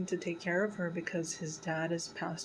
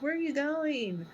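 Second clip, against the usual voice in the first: higher, mock-tearful whine; fake crying mother; 9 semitones higher, 3 dB louder than usual. Retelling the mother's fake crying at her son, mock-tearful and higher. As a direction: imitate a mother faking tears to guilt him. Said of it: "she was, like, crying, but she wasn't really crying".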